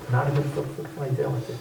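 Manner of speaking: softly repeated, almost whispered; a breathy aside to drive the point home; near whisper. Real, not acted. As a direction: drop to a hush on "for fidelity". Quiet repeated emphasis.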